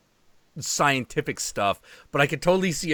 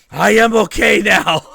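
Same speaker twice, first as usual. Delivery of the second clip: laughing through the words, much louder; 8 semitones higher, 12 dB louder than usual. Real, not acted. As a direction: catch your breath between giggles, loud. Recovering from a laughing fit.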